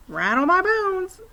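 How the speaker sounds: a parrot hamming up a ghost; spooky parrot. A narrator's reading. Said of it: "imitation of a spooky voice".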